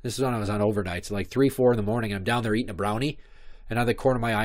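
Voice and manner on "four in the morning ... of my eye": usual speaking voice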